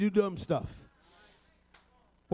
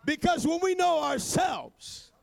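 higher, shouted, rising sermon climax; preaching at full force; 5 semitones higher than usual. Acted. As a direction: build the church up to its feet.

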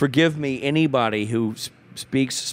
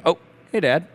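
higher, casual cover-up greeting; kids switching innocently; 5 semitones higher than usual. Retold or imitated. Kids caught by dad walking in, suddenly casual and higher. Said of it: "and they switch".

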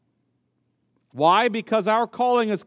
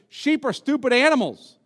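quoting elders; 6 semitones higher than usual. Retold or imitated. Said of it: "they actually say this".

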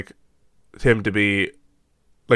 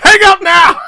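shouting; 13 dB louder than usual. Retold or imitated. Shouted movie quote.

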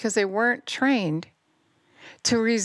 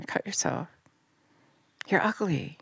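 soft whispered taunt, much softer; demon whisper; 2 semitones lower, 4 dB quieter than usual. Acted. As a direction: hiss it like a demon in a child's ear. Voicing demons speaking to children.

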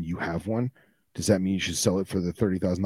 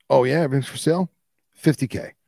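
quick, higher sarcastic retort; mocking employer; 6 semitones higher, 5 dB louder, faster than usual. Employer answers a pushy customer, quick sarcastic voice. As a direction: toss the price back like a dare. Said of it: "He says".

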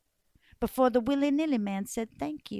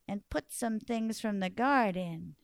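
plain reading voice

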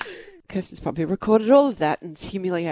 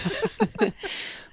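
soft high laugh; 11 semitones higher, 5 dB quieter than usual. Real, not acted. Reacting to the pregnancy joke.

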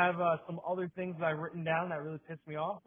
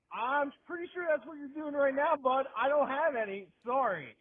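high, louder retold comeback; sarcastic retort; 9 semitones higher, 5 dB louder than usual. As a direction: push back high and emphatic. Retold retort to begging man.

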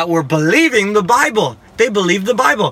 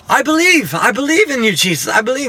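sassy prayer; 6 semitones higher than usual. Retold or imitated. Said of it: "the sassiest prayer you've ever heard".

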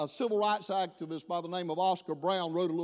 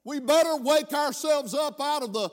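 louder, higher impassioned preaching; fiery preaching; 5 semitones higher, 5 dB louder than usual. Real, not acted. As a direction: preach it with rising force. Preaching at full force.

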